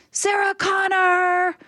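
high, slow called-out name; stranger calling out. Retold or imitated. Stranger recognizes her on the street, high slow call. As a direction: hail her by name from afar. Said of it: "This was fully just".

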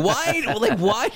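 very high-pitched exasperated cry. Real, not acted. Radio guest fed up with a Christmas song, exasperated. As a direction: wail it up high in comic exasperation.

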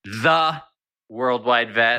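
emphatic correcting "The"; stress on THE. Real, not acted. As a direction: land hard on "The" before "Worldwide Vet". Correcting the article in the title.

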